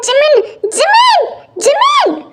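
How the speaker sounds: high, drawn-out calling shout; shouting for help. Acted. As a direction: yell the name up high, stretching it out. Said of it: "इतनी जोर से चिल्लाऊंगा ना". (ज़ोर से चिल्लाकर पुकारना)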